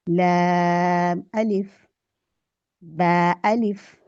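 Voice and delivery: अलिफ drawn out, falling; slow correct reading. Shown as a demonstration. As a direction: stretch अलिफ slowly. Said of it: "लाम अलिफ ऐसे नहीं पढ़ेंगे". (अलिफ को धीरे खींचकर)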